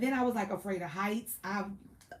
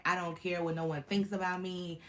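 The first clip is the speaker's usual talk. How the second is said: usual speaking voice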